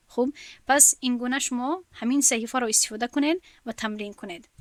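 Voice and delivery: usual speaking voice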